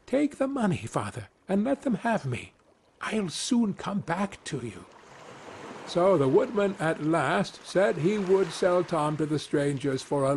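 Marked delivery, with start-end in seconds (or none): 0.0-4.8 whispered. A narrator's reading. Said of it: "whispered in his ear".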